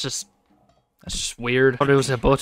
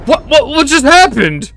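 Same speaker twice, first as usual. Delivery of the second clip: shocked disbelief; 10 semitones higher, 5 dB louder than usual. Real, not acted. Gamer baffled by what just happened, shocked. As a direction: blurt it out in astonishment. Baffled by sudden game event.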